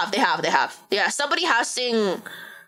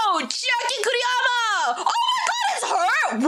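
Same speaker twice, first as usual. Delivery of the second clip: high, excited gasp and squeal of recognition; sudden starstruck surprise, pitched way up; real shocked gasp; 13 semitones higher than usual. Real, not acted. Recognizes actress, gasps and laughs.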